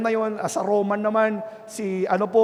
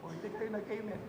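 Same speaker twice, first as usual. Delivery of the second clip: much softer, as if off mic; a faint off-mic "hindi kayo nag-amen"; barely audible; 18 dB quieter, faster than usual. Shown as a demonstration. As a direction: drop to a faint distant voice. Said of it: "walang mic".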